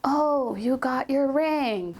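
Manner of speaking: mock unimpressed. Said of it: "She was like".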